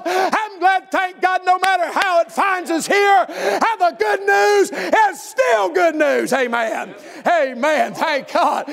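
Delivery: driving revival sermon cadence; full-force preaching. Real, not acted. Preacher rallying church to spread good news, full-force preaching. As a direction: preach it hard, stretching the big phrases.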